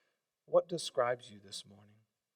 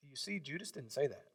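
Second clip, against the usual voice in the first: usual speaking voice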